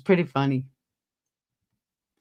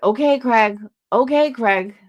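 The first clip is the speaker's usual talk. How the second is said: exasperated brush-off; 5 semitones higher than usual. Retold or imitated. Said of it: "you could really hear it in her voice".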